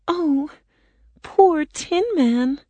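put-on high, slow girlish sympathy; pitying girl. A narrator's reading. Dorothy seeing her rusted friend, high pitying voice. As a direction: draw it out with pity. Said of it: "the girl said".

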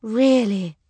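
loud high "Really" with a firm falling tune; cartoon princess. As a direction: answer "Really" firmly as the princess. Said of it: "she said".

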